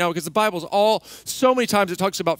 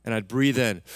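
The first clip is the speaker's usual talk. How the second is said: guided prayer; 5 semitones lower than usual. Acted. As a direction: ease them gently into letting go. Leads congregation in breathing prayer.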